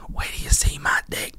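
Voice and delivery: breathy whispered song line; hushed whisper of a rap lyric. Pretend. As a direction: whisper it like a sly secret.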